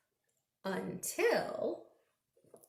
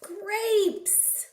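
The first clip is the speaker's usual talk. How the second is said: much higher bright answer; excited reveal; 10 semitones higher, 3 dB louder than usual. Acted. Revealing answer to kids, excited. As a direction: announce it gladly.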